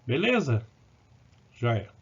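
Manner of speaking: normal talking voice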